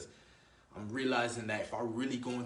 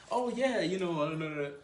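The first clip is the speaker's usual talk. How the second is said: high, loud flustered backpedal; pitched-up mock people-pleasing babble; mock caving in; 6 semitones higher, 5 dB louder than usual. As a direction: imitate someone dropping character to agree. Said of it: "I don't change. Like, she says something".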